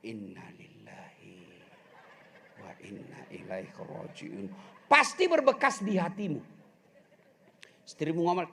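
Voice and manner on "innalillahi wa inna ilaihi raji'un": slow proper recitation. Shown as a demonstration. Preacher shows husbands the proper recitation, slow and soft. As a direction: recite it slowly and softly in full. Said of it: "Dan perbaiki ucapannya".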